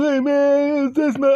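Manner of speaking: high loud pained plea; stunned victim, voice up high; high, shocked friend. Acted. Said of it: "He goes".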